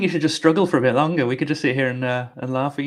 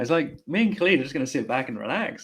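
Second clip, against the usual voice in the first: ordinary talk, nothing marked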